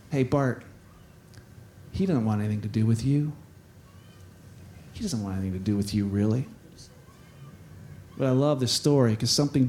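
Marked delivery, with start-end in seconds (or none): 0.1-6.5 very low, soft, insinuating; inner voice. Acted. Doubt inside Bart's head talks to him, insinuating. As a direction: plant doubt quietly. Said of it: "the voice on the inside".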